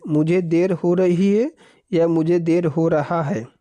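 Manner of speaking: normal talking voice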